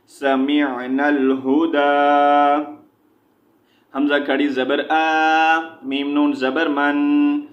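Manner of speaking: measured chant, syllables held long; Quran spelling drill. Shown as a demonstration. Teacher spelling Quranic words for learners, chanted drill. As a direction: spell out each letter, then draw out the joined syllable.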